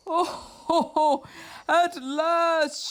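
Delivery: high, delighted Oh ho ho; joyful shout. A narrator's reading. Toad sees his seeds sprout, high and joyful. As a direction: burst out with happy relief. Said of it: "shouted Toad".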